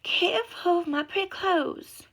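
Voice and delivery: high, loud fussy voice; woman buried in clothes fussing about her dress; babbling lady. A narrator's reading. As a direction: fret over the clothes. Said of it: "a voice babbled from the deep".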